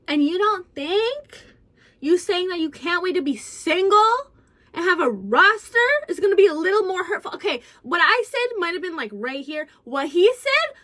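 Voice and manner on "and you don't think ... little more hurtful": indignant comeback. Retold or imitated. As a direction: fire the question back, outraged. Firing back at boyfriend.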